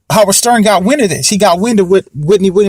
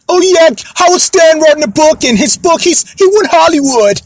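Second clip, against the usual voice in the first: Wendy Williams impression; 7 semitones higher than usual. Vlogger mocking a talk show host's voice. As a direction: mimic a gossip talk host dishing on a book. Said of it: "that's how she sound".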